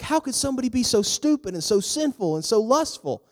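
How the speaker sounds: high-pitched, indignant disbelief; outraged king. Acted. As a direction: rail at a stranger's wrongdoing. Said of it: "David was so mad at this guy".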